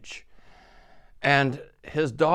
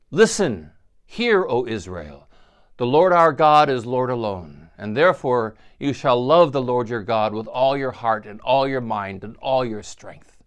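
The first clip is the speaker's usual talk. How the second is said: prayer recital; 5 dB louder than usual. Acted.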